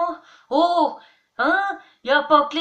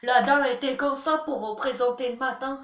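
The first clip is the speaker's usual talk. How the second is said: ordinary delivery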